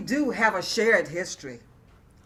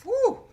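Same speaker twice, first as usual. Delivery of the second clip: whistling whew; 14 semitones higher, 6 dB louder than usual. Acted. Exclaiming at the hardship.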